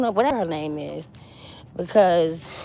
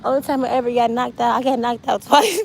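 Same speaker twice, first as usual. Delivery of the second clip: higher, sheepish put-on voice; mocking Clarissa; 5 semitones higher than usual. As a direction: fumble the excuse with a laugh. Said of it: "She gonna be like".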